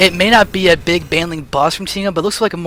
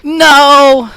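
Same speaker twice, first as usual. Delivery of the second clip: frustrated outburst; 7 semitones higher, 6 dB louder than usual. Real, not acted. Disappointed, doorbell wasn't computer.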